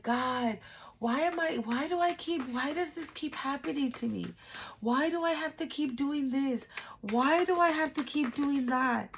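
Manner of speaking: high, pleading whine of frustration; plaintive complaint. Acted. Listener asking God why it keeps happening, plaintive whine. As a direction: plead upward, question after question.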